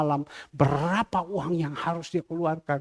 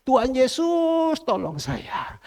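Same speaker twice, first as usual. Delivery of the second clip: high, long-drawn call to Jesus; pleading prayer; 11 semitones higher, slower than usual. Retold or imitated. Praying while circling the church, pleading. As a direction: stretch "Yesus" out as a plea.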